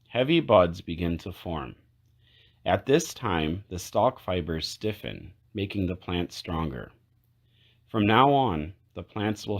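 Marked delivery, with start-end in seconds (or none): none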